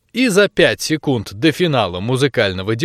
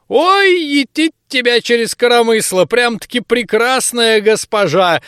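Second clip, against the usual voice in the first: high, loud granny exclaim; delighted old woman; 10 semitones higher, 4 dB louder than usual. Acted. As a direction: crow with delight like an old crone. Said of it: "восхитилась бабка". (восхищённая бабка, высоко и громко)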